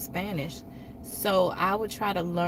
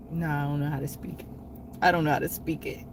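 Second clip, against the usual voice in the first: husband's reply; 4 semitones lower, much faster than usual. Retold or imitated. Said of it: "He's like".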